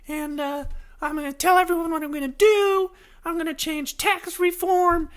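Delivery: very high, hammed-up falsetto of a gung-ho candidate; cartoonish squeaky campaign voice; eager candidate. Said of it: "you're like".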